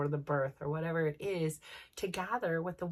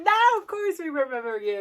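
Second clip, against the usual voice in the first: excited welcome; 9 semitones higher, 8 dB louder than usual. Retold or imitated. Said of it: "And they're like".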